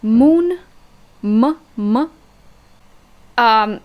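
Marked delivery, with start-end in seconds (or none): 0.0-2.1 M said like m in moon; Russian M sound demo. Shown as a demonstration. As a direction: hum a clear M, as in moon. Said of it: "like English M".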